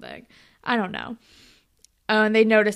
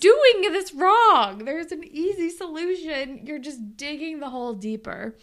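mock scolding; 6 semitones higher, 5 dB louder than usual. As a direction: scold him like a fed-up coach. Mocking a player's bad move.

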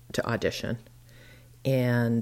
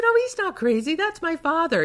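surprised; 5 dB louder than usual. Retold or imitated. Recognizes her father through door.

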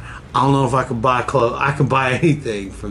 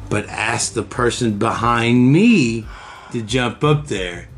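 ordinary delivery

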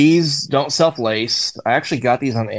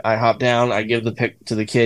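ordinary delivery

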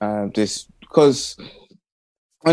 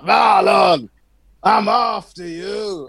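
deep voiced child; 7 semitones higher, much slower than usual. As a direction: shout it like a menacing kid. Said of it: "He's a kid with a deep voice".

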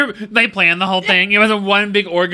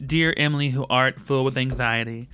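mock prayer; 7 semitones lower than usual. Imagined prayer to Emily.

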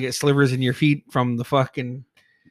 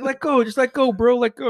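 high, pleading egging-on; urgent encouragement; 9 semitones higher, 3 dB louder than usual. Retold or imitated. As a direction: imitate a friend pushing you to do it. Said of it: "he's encouraging me".

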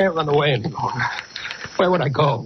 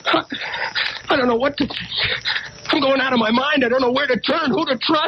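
frantic fear; 9 semitones higher than usual. Acted. Arthur panics, fears for life.

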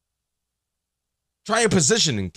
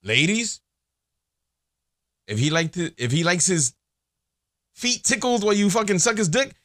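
normal talking voice

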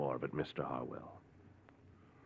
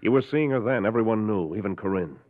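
raised, pointed accusation; accusing; 6 semitones higher, 5 dB louder than usual. Acted. Doctor confronting a suspected killer, accusing. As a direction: throw it in his face. Doc confronts Harwell about past.

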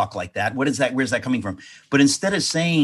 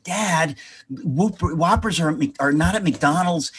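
put-on higher-pitched, mortified kid correcting dad; pleading, pitched-up protest; cringing embarrassment; 5 semitones higher than usual. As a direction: imitate an embarrassed twelve-year-old. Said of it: "cringing in embarrassment, as you do when you're twelve".

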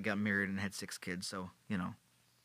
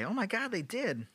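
mock shock; 9 semitones higher than usual. Mock queen's shocked reaction.

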